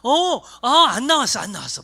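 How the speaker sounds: pastor's mock reply to a student, loud and eager; mock eager agreement. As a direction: play along loudly as if conceding. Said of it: "뭐라고 대답했을까요?".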